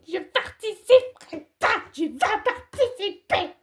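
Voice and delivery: rising from stern to high shrieking; escalating quarrel. Acted.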